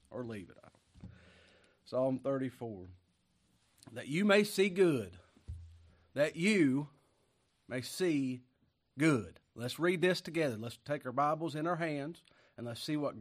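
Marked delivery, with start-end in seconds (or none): 3.9-9.4 slow, loud, drawn-out scripture proclamation; preaching at full force. Real, not acted. Pastor reading Psalm thirty-four to congregation, loud and slow. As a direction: proclaim each word like a pulpit cry.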